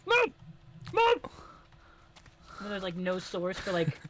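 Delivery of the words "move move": high yelling move; shouting. Real, not acted. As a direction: bark the order loudly. Shouting at a character in game.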